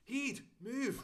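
loud, high Scottish bellow; Mike Myers' dad. Acted. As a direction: bark it like a rowdy Scottish father. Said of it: "it's the best Scottish accent".

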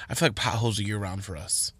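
hushed aside; whispering. Real, not acted. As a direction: drop to a whisper. Aside about potholes.

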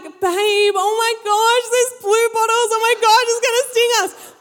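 high-pitched mock panic; freaking out. Surfer's girlfriend panicking to him about bluebottles, freaking out. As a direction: imitate a girlfriend having a meltdown. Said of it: "just starts freaking out".

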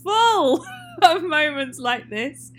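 loud, high, laughing through the words; laughing incredulously. Real, not acted. Co-host cracking up at a three-hour film's absurdity, laughing loudly. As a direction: drag out the words, helpless with laughter.

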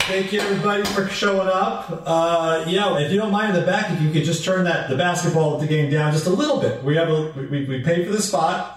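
softly pleading host voice; polite, timid host. Acted. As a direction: meekly address an indifferent crowd. Bobby addresses the bar politely.

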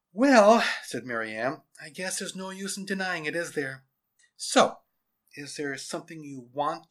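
lightly voiced teacher conceding; teacher giving in. A narrator's reading. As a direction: concede it, then ask what he wants. Teacher speaks to pupil in story.

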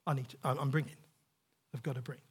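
low, subdued muttering to oneself; a quiet, halting, dutiful inner thought; Cain's inner voice. Acted. Cain bringing an offering out of duty, quiet halting voice. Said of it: "God sees Cain's heart".

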